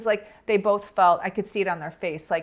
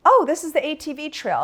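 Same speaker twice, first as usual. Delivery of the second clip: high, louder retold answer; lifted, matter-of-course reply in a higher voice; breezy explanation; 9 semitones higher, 5 dB louder than usual. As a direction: imitate a friend's airy explanation. Friend answers her alarmed question.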